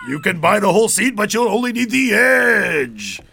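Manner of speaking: shouted, rising hype with a stretched last word; loud, high arena bellow; monster truck announcer. Shown as a demonstration. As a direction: holler the ticket pitch like a rally ad. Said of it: "the Monster Truck guy".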